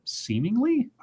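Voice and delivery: high, quiet incredulous echo. Real, not acted. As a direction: question the word in disbelief.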